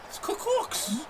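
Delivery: put-on squeaky over-the-top Boston accent; mock Boston accent. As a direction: parody a Boston guy saying cooks. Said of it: "He sounds like I'm from Boston".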